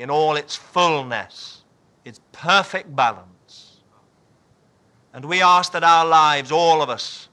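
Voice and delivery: reverent spoken prayer. Real, not acted.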